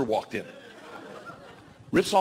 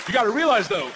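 ordinary talk

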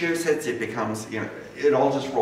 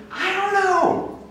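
high, slow, drawn-out mock uncertainty; unsure George; 5 dB louder, much slower than usual. Friend on the phone, unsure if anyone is suing, in a lightly voiced high drawl. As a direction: stretch it out like a friend who has no idea.

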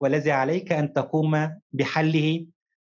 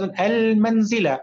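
"المنزل" with its opening "al" held long and clear; stress on the article; 4 semitones higher, much slower than usual. Shown as a demonstration. Teacher points out the lunar article to pupil, "al" in "المنزل" stressed. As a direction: lean slowly on the "al" of "المنزل". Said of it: "أريد كلمة تبدأ بال القمرية".

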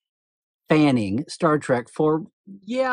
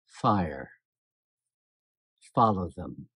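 much lower, icy calm order; dead calm villain; 9 semitones lower, 6 dB quieter, slower than usual. Retold or imitated. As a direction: imitate the villain's flat, chilling command. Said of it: "she is so dead calm".

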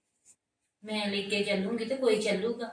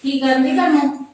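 loud, higher call across the house; 5 semitones higher, 11 dB louder than usual. Acted. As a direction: call her from the next room. Sister-in-law calling from afar. (ਉੱਚੀ ਆਵਾਜ਼ ਵਿੱਚ ਪੁਕਾਰਨਾ)

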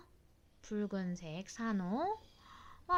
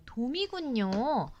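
ordinary talk, nothing marked

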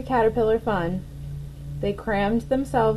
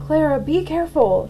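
higher and louder, worried; alarmed shout; 7 semitones higher, 5 dB louder than usual. A narrator's reading. Cornelius warns Clara about the crow, loud and alarmed. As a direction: shout a warning. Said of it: "screamed Cornelius".

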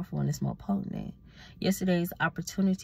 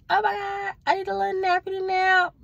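put-on high, louder sing-song baby voice; cutesy baby talk; 13 semitones higher, 6 dB louder than usual. Sleepy vlogger to her viewers, high cutesy baby talk. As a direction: coo it like a sleepy toddler.

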